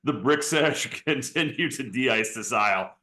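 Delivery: usual speaking voice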